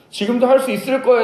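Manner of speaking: high, loud, emphatic claim; overconfident declaration. Acted. Disciples insisting they can still do it, loud overconfident voice. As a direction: proclaim it as a sure thing.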